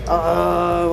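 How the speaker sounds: ordinary talk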